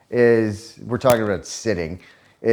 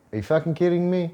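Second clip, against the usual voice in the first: sarcastic; 8 semitones higher, faster than usual. Retold or imitated. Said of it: "or if I'm being sarcastic".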